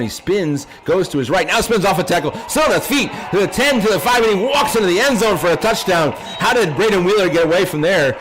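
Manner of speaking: rising excited play-by-play shout; touchdown call. Real, not acted. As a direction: build to a yelling touchdown call.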